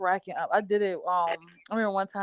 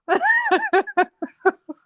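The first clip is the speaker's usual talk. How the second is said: high, loud burst of laughter; helpless high-pitched cackle; real laughter; 6 dB louder than usual. Real, not acted. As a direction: crack up at your own story. Cracks up at own story.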